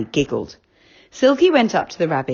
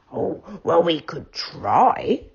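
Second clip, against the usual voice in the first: slow growly teddy bear; a worried teddy growling slowly; Moonface, worried, growly; slower than usual. A narrator's reading. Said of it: "said Moonface with his growly voice".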